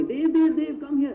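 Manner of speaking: high, loud call across a crowded hall. Retold or imitated. Mentee waving Dev over at a conference, loud calling out. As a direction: call his name from far away.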